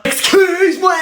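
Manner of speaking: very high, indignant exclamation; outraged. Acted. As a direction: take huge offence, voice shooting up. Teacher affronted by pupil's reproach. (indignation outrée, voix perchée)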